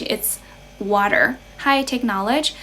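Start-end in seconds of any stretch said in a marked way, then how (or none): 0.8-1.3 American "water" with a sounded final r; American accent. Shown as a demonstration. Streamer demonstrating English accents to viewers, American accent. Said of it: "in American English".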